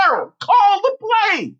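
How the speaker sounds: coach's yell. Retold or imitated. Coach yells at his stalled player, high holler. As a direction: holler it across the field as the coach. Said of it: "The coach yelled".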